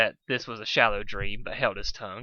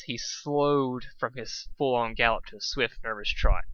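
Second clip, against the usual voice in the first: usual speaking voice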